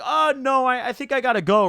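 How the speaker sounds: high, loud panicked exit; flustered girl backing away; mock horrified girl. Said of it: "She looks horrified".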